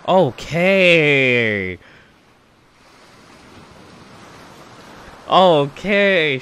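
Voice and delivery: loud, high, drawn-out hyped okay; excited. Real, not acted. Reactor stunned by the finished song, loud excited okay. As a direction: let it ring out, impressed and fired up.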